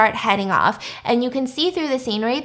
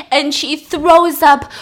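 usual speaking voice

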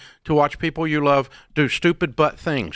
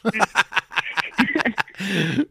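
long, higher-pitched chuckle; drawn-out laugh, pitched up; amused laugh; 6 semitones higher than usual. Real, not acted. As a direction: laugh heartily at your own joke. Laughs at own dad-voice joke.

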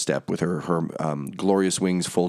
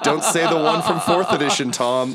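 laughing inner plea; 10 semitones higher, 4 dB louder than usual. Retold or imitated. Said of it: "and I was like".

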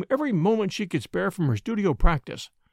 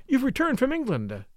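higher, bright-lifted greeting of joy; light up at his return; joyful; 8 semitones higher than usual. A narrator's reading. Young girl greeting the returned professor, higher and joyful. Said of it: "she said joyfully".

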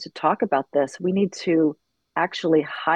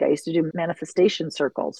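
usual speaking voice

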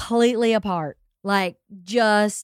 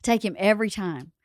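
ordinary talk

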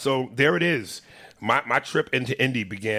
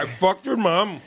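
higher mock Indian accent; horrendous Indian accent; 9 semitones higher, 4 dB louder than usual. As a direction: imitate the Indian scammer's insult. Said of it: "in that fucking horrendous accent".

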